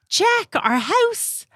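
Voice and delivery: high, drawn-out mock call; bingo player calling. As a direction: call it out across the bingo hall. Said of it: "if somebody goes".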